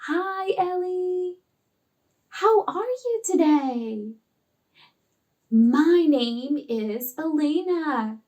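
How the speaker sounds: put-on slow, drawn-out friendly greeting to a shy child; slow, soothing teacher greeting; calm, slow talk. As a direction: stretch each word out gently and calmly. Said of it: "She was talking slowly".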